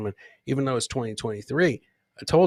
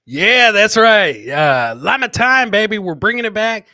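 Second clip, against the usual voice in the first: hyped shout; 6 semitones higher, 12 dB louder than usual. Real, not acted. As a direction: stretch it out big like a hype man. Hyping after llama clip.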